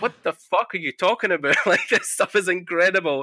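high, laughing mock outrage; retold flabbergasted reaction, high and giggly; laughing disbelief. Quoting their disbelief at Ryan.

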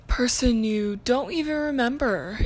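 ordinary talk, nothing marked

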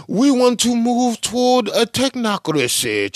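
high mock voice, reading his words back; mocking Schwab quote. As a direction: parody the speaker he's quoting. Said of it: "Schwab said".

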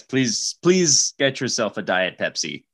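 ordinary talk

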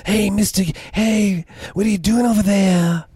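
put-on high-pitched rural Oregon accent, "you pull over now" slow; mock a creepy rural Oregon checkpoint guy. Mocking how rural Oregon checkpoint guys talk, high rural Oregon accent. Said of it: "the Oregon accent".

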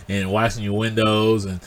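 ordinary talk

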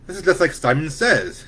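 high, loud and thrilled; boy delighted by his new trick; excited exclaim. A narrator's reading. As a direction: burst out with glee. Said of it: "Stephen exclaimed".

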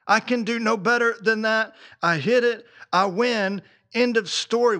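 punchy acted certainty, each phrase final; triumphant. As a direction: declare it like an undisputed winner. Voicing a triumphant bullseye hitter.

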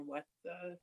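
ordinary delivery

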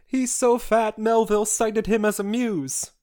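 normal talking voice

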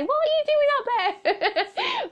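very high mock-scolding mum, breaking into a laugh; mum calling out. Mum coming into the hallway to check on her, mock scolding. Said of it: "I'm worried my mum's gonna come out".

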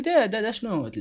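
high, puzzled double take; retelling his own bewildered what-is-this; baffled. Retold or imitated. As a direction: react like you can't believe your ears. Bewildered by code-switching speakers.

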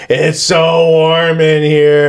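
slow, hammed-up wail of complaint; complaining. Overheated defendant griping in court, hammed-up complaining. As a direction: moan it out, stretching every word. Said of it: "just complaining the entire time".

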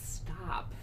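soft, whispered stop; teacher whispering to a cocky kid; hushed warning. Retold or imitated. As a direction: quietly rein him in. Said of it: "I'd be like".

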